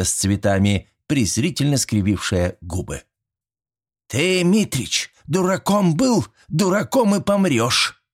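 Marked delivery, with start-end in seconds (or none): 4.1-8.0 high, slow, drawn-out disdain; haughty granny put-down; scornful old woman. A narrator's reading. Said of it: "фыркнула бабулька".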